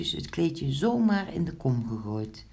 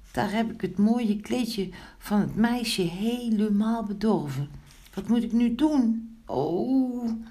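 high-pitched storybook lament; dismayed; 7 semitones higher than usual. A narrator's reading. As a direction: voice a little figure upset at a mishap. Figure distressed over spoiled cloth. (hoog en klaaglijk)

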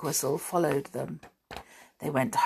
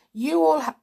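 hiccups; 10 semitones higher, 5 dB louder than usual. Real, not acted. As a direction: cut off by a hiccup. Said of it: "I've got the hiccups now".

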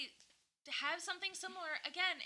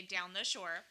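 "down the shore" in local Delco speech; Delco accent; 2 semitones lower, slower than usual. Shown as a demonstration. Co-host showing how Delco folks say it, Delco accent. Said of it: "we are from Delco. We say".

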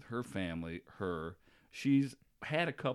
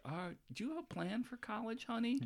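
lightly voiced sweet mom, a bit higher; mom's voice; 11 semitones higher, 8 dB quieter than usual. Son retells his mom asking about college, softly higher. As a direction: ask like a gentle mother.

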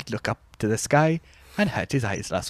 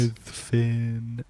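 announcer tag. Acted. Jingle tag line.

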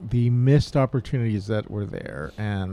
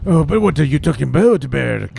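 put-on high, loud, over-the-top French accent; hammed-up cartoon Frenchman's accent; 3 semitones higher, 7 dB louder than usual. Cohost mimics the Merovingian's French-accented dialogue, hammed-up French accent. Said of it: "Pepe Le Pew was probably".

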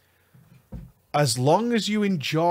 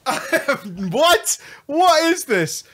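high, loud outraged "what?"; mock horrified reaction; shocked disbelief; 12 semitones higher, 5 dB louder, much slower than usual. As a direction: squawk in disbelief. Reacting to steamy novel.

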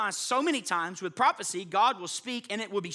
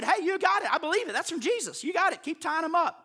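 excited Paul; 9 semitones higher than usual. Acted. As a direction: voice Paul cheerfully brushing it off. Preacher voices Paul excitedly.

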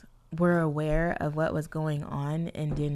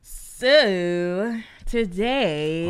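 very slow, louder, stretched-out announcement; drawn-out intro; 5 dB louder, much slower than usual. Real, not acted. Host kicking off today's hot topic, drawn out. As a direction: stretch each word out long and loud.

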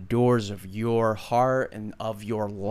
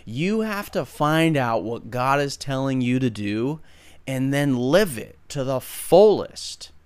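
ordinary talk, nothing marked

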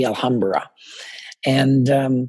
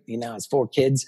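ordinary talk, nothing marked

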